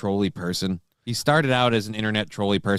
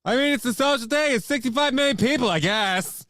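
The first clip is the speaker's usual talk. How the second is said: very high parody commentator voice; mock sports ranter; 12 semitones higher than usual. Co-host mocking the sports clip, high ranting impression.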